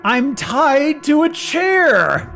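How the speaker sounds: slow, high shouted protest; indignant shout. Acted. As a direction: bellow it out in outrage. Tied-up captive protesting.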